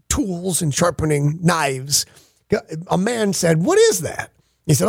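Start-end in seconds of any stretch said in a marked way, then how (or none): 3.6-4.3 mock wonder, pitched way up; high-pitched put-on curiosity; feigned curiosity. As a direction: act amazed at the mystery object. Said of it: "And the man pretended to".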